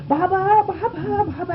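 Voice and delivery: high mock scream for help; imitating frantic calls from a bolting horse; retold loud scream. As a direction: cry out high, reenacting the terror. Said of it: "I'm screaming so loudly".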